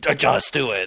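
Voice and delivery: a hint of a barking drill sergeant; playing a drill instructor for a laugh; mock drill sergeant. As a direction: order the recruit about, lightly. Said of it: "I can't do that impersonation".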